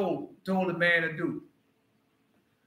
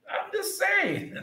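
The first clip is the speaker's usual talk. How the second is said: mock innocent; 4 dB quieter, slower than usual. Real, not acted. Preacher backs off after threat.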